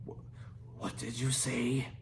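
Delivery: low, soft hissed question; hissing. A narrator's reading. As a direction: hiss it with narrowed eyes. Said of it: "hissed Fireworm".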